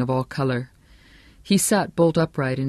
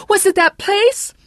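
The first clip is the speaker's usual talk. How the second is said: very high, loud, slower shriek; screamed question; 8 dB louder than usual. A narrator's reading. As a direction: scream it out, stretching the words. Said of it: "Shelley Chatsworth screamed".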